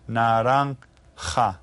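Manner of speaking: "Naranja" very slowly, j sounded like an H. Shown as a demonstration. Teacher explains the letter J, J said as H. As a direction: stretch out "Naranja", landing on an H-like j. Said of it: "is pronounced like an H again".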